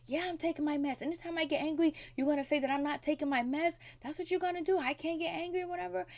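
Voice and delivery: mock-indignant, high-pitched retort; imitated angry protest. Recapping Ian snapping back at his sister about meds, mock angry. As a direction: act out his defensive outburst, pitched well up. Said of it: "he's yelling, he's screaming".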